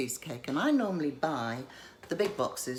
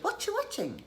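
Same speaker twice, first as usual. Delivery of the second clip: much higher, chirpy friend calling to check in; friend's voice. Retold or imitated. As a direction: play the nosy friend on the phone, pitched way up. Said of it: "my friends will call me … and say".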